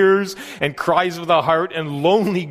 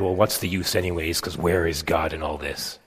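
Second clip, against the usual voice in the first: doubtful inner voice; 10 semitones lower, 6 dB quieter than usual. Retold or imitated. Doubting thought during prayer.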